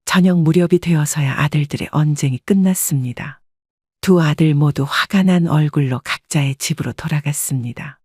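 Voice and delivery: ordinary delivery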